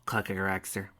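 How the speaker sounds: ordinary delivery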